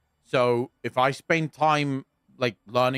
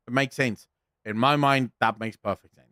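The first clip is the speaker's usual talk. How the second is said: normal talking voice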